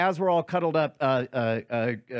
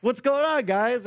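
lightly voiced, higher cheerful hello; Josie's greeting; 8 semitones higher than usual. Daughter walks in greeting everyone, mildly higher and cheerful. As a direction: breeze in asking what's up. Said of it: "Josie comes up and says".

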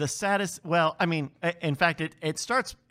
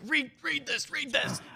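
urgent gasping plea; 11 semitones higher, 3 dB quieter than usual. Retold or imitated. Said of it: "he's like".

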